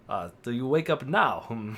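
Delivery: badly faked Russian accent; shaky Russian accent. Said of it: "I can't do a Russian accent".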